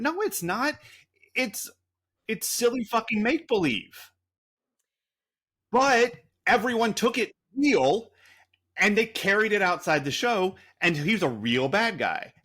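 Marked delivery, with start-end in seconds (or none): 0.0-9.5 exasperated outburst. Real, not acted. Exasperated at fans taking it seriously.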